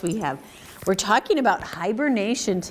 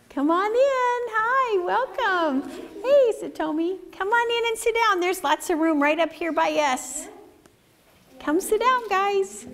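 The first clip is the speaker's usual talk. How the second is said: welcoming newcomers; 10 semitones higher than usual. Real, not acted. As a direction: invite them in with a lilting high voice. Welcomes children joining story time.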